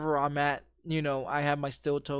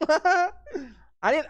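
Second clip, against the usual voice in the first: laughing; 10 semitones higher, 4 dB louder than usual. Real, not acted. Laughing at his own story.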